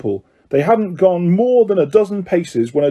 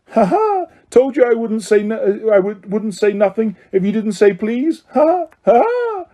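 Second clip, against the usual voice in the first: high, triumphant cackling taunt; gloating poltergeist; 6 semitones higher than usual. Acted. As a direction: crow over the trick. Peeves gloating, tricking Filch.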